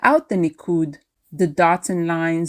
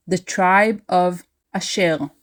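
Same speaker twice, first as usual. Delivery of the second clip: ordinary delivery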